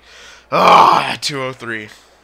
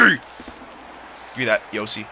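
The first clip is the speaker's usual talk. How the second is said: whisper; 6 semitones lower, 13 dB quieter, much slower than usual. Real, not acted. Quiet plea after a blunder.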